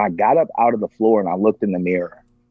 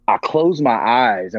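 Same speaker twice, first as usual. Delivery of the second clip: usual speaking voice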